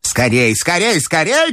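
high, urgent granny command; commanding grandmother. A narrator's reading. Grandmother urging her bearers on, commanding. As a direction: hurry them along in a high old-lady voice. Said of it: "командовала бабушка".

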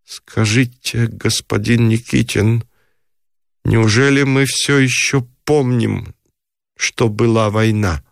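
"Скажите" drawn out slowly, then each word set apart; every word stressed. A narrator's reading. Frau questioning her guest about the war, every word stressed. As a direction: weigh each word of "неужели мы всё ещё помним", pausing between phrases. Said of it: "выделяя каждое слово".

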